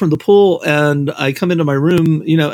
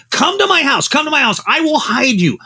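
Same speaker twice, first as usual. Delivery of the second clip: pitched-up urgent plea; urgent high insisting; 10 semitones higher than usual. Retold or imitated. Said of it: "And Harlan's like".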